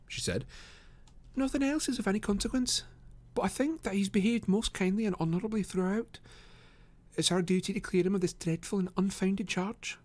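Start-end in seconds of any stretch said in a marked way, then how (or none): 1.3-10.0 put-on high-pitched young lady, restrained volume; Miss Morstan, earnest. Lady defends an accused man, high and earnest. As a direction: plead his case in a high voice.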